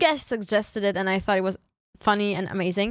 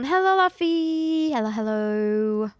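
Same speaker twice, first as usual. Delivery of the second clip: sing-song greeting; 4 semitones higher than usual. Acted.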